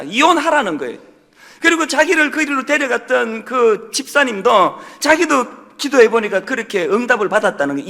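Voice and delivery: normal talking voice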